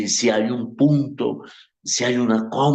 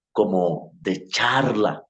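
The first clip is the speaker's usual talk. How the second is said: normal talking voice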